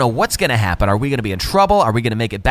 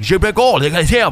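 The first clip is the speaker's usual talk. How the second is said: higher, louder mock French accent; imitating a French policeman; 7 semitones higher, 4 dB louder, faster than usual. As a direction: gabble in exaggerated, loud French. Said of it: "little French accent".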